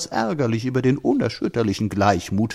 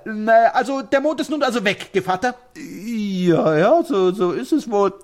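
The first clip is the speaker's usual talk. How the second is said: a touch higher, easygoing peasant voice; lightly voiced old villager; 7 semitones higher, 4 dB louder than usual. Gevatter answers Chup about the vanished moon, easygoing and unbothered. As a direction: shrug it off like an unbothered old man.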